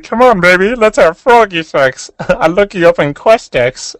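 high, loud froggy Kermit voice, slow; hammed-up high Kermit pickup line. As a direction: croon it like a lusty Kermit. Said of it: "my friend was like".

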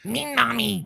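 mock devil voice. Host mimics the friend's mean mommy voice, mock devil. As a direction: put on a playful devil growl. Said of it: "with devil voice".

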